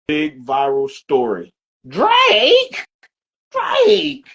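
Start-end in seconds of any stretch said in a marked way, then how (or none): 1.9-4.3 high-pitched incredulous cry. Acted. Podcast host mimicking a rapper over a viral story, high incredulous squeal. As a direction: squeal the name as a drawn-out question. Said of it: "In my, in my [name] voice".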